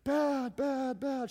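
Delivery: put-on high, small repeated boy's answer; boy telling mom how he feels; ashamed kid. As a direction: answer like a guilty child.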